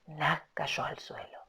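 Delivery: slow hushed whisper; awed slow whisper. A narrator's reading. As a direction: drop to a whisper for the fall. After the gunshot, hushed.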